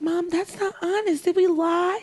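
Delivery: put-on high little girl questioning; child voice. Little girl questioning her mom, high-pitched. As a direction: ask it like a small indignant kid.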